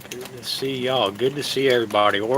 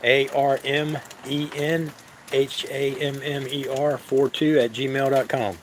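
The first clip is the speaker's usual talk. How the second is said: slow dictation; 2 semitones higher, slower than usual. Shown as a demonstration. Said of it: "you can email at".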